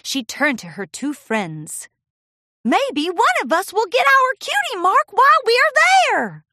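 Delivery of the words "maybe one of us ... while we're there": put-on high, loud kid voice; hopeful filly. Apple Bloom to her friends, high hopeful voice. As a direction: say it with hope.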